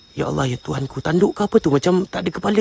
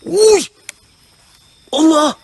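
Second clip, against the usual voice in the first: startled; 7 semitones higher, 6 dB louder, slower than usual. Real, not acted. Startled by something in the dark.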